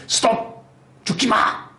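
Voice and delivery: high urgent cry to stop; calling out stop. Retold or imitated. Preacher as Paul calls out to stop the jailer from dying, high urgent cry. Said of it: "이렇게 외치지 않습니까".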